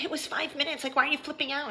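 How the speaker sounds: mock a dismissive excuse; belittling put-on high voice. Mimicking sister's dismissive excuse.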